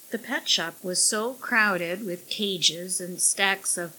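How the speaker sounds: ordinary delivery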